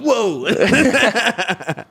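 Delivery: mock startle. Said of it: "and I'm like".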